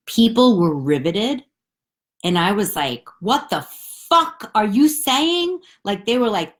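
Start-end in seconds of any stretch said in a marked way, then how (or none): 3.2-5.6 incredulous outburst. Retold or imitated. Retold confused outburst.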